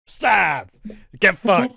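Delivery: sudden loud yell; shouting. Retold or imitated. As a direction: bark it out loud and angry. Acted clown attack, shouting.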